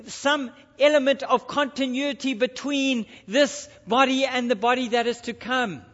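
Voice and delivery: ordinary talk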